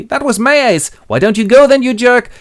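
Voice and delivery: loud, high angry outburst; acted card-table row. Willie and Charlie fight over cards, irritated.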